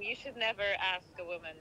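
ordinary delivery

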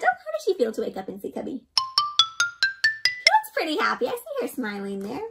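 usual speaking voice

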